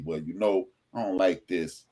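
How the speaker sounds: low, soft and measured; calm mature tone. Retold or imitated. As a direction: state it calmly like an adult. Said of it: "we should be able to say".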